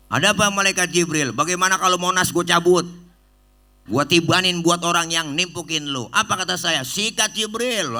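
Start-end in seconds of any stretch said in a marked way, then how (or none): 0.1-6.1 loud, fast and high; fast loud excited offer; eager exchange. Acted. Preacher imagines Jibril's offer to him. 6.8-8.0 eager go-ahead. Acted. Preacher jokingly accepts revenge.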